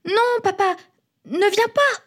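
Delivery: put-on high, alarmed kids' plea; protesting children. A narrator's reading. Children tell papa not to come sleep outside, protesting.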